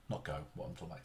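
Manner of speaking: breathy whispered correction under his breath; quick self-correcting whisper; whispered aside. Real, not acted. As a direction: drop to a whisper to fix the slip. Muttered aside correcting himself.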